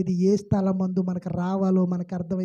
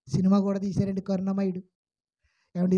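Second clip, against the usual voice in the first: usual speaking voice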